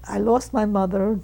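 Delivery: usual speaking voice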